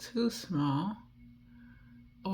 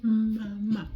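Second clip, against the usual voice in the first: put-on higher, babbling like a baby; baby babble; 7 semitones higher, slower than usual. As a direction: coo like a baby. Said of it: "reminds me of a baby".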